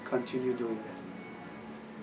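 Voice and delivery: put-on calm a young student with kindness; gentle rabbi. The rabbi gently approving his Kabbalah study, hushed.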